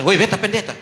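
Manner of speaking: calling out. Retold or imitated. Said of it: "Tapi saya bilang".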